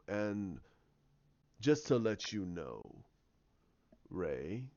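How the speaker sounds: low, slow villain reveal; low voice with long, ominous pauses; sinister villain. Acted. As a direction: let the revelation out slowly and low. Villain's parting revelation.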